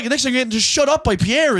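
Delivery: ordinary talk, nothing marked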